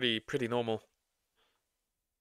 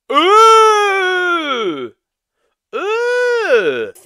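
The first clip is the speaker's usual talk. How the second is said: loud high pained groan; disgusted cry; 9 dB louder than usual. Real, not acted. Disgusted cries.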